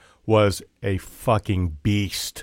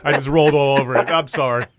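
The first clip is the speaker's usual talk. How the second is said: laughing; 6 dB louder, faster than usual. Real, not acted. Apologizing while laughing.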